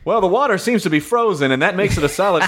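high, bright play-by-play call; sports announcer. Acted. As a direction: announce it like live TV. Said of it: "start announcing".

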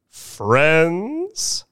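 high oily host voice; hint of a slick showman; showman greeting. A narrator's reading. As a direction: greet the crowd like a smooth showman. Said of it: "said Mister Thurkle".

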